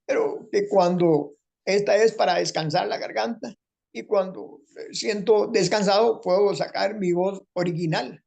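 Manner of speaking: high, slow, strained voice with a catch; a grateful man in a high strained voice; Rafael Guillén's changed voice. Retold or imitated. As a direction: speak slowly in a pinched high throat voice. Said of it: "esta voz".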